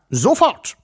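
short, high-pitched yell; roared order. A narrator's reading. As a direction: fire off an urgent command. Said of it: "brüllte er". (hoch und scharf brüllen)

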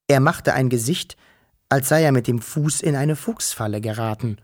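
plain reading voice

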